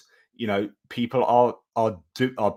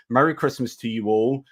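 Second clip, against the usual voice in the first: ordinary delivery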